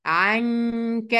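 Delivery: عنك slow, the noon hidden with a held nasal hum; slow عنك with ghunna; ikhfa with ghunna. Shown as a demonstration. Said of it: "هنا إخفاء بغنة".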